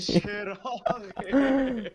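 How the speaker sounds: said through a high laugh; high laughing reply. Real, not acted. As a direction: laugh the word out. Laughing at scared friend.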